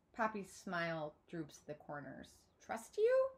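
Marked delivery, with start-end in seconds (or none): 2.6-3.3 surprised. A narrator's reading. Father questions daughter.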